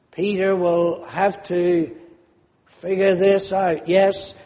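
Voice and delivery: ordinary talk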